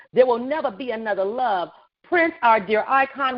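ordinary talk, nothing marked

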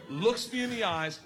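normal talking voice

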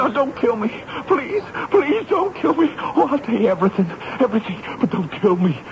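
high, desperate pleading, rushing; begging for life. Acted. Prisoner begging the major not to kill him, desperate pleading. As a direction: beg for your life in panic.

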